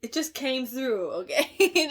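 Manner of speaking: loud, high, laughing through the words; laughing apology. Real, not acted. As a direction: giggle it out, a bit helpless. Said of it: "I'm sorry".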